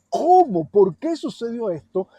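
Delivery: loud, high cry of lament; loud grieving cry; anguished outcry. Acted. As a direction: shout the question to heaven. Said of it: "podemos ver como".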